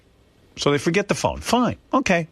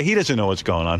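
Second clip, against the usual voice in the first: ordinary delivery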